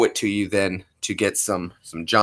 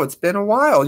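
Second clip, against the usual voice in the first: normal talking voice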